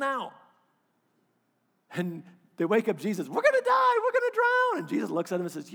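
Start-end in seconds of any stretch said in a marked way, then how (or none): 3.3-4.8 high, loud mock panic with a laugh; panicked disciples. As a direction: cry out like terrified sailors.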